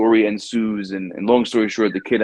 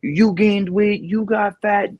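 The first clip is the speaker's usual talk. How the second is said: much higher, mock outraged dad; dad flipping out; 11 semitones higher than usual. Father scolding his son over the failed pull-ups: high mock outrage. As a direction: imitate a father blowing up at his son, pitched way up. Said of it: "starts flipping out".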